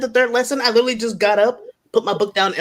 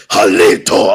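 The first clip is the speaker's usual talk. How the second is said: "Jalito" much lower and louder, from the chest; hint of fierce uncle; 14 semitones lower, 7 dB louder, slower than usual. Imitating uncle teaching Choctaw, "Jalito" lower and forceful. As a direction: growl "Jalito" like a stern teacher. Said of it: "He's like".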